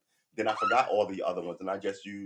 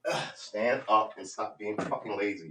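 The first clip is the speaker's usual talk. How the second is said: slowed, exasperated self-scolding in an odd voice; slow, fed-up telling-off to himself; slower than usual. Real, not acted. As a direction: chew yourself out under your breath. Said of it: "you just heard me talk to myself like this".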